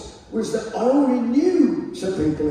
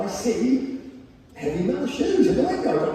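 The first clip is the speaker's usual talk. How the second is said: ordinary delivery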